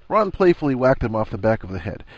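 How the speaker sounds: ordinary delivery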